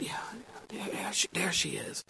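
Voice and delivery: very soft, breathless whisper; whispered sighting of the bride. Retold or imitated. Groom spotting his bride down the aisle, whispering.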